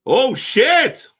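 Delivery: high, loud "oh shit"; surprised shout. Real, not acted. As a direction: blurt it out, stunned. Wrestlers' entrance surprise.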